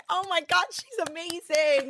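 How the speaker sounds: high, thrilled gushing; excited gushing. Retold or imitated. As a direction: gush like proud parents jumping around. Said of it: "we were like".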